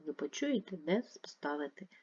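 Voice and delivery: ordinary talk, nothing marked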